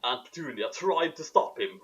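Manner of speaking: usual speaking voice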